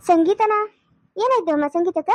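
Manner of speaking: shocked question. Acted. Friend on the phone, startled to hear of the hospital. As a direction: jolt up in alarm. Caller hears shocking news.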